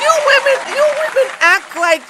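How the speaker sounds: high, loud call-out to women; exasperated high yell at the viewers; loud scolding. Real, not acted. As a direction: shout it at them, finger wagging.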